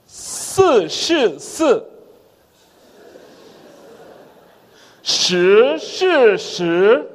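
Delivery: "s" and "x" said as crisp Chinese syllables; tongue twister in Chinese sounds; Mandarin sound drill. Shown as a demonstration. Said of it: "Aí vem".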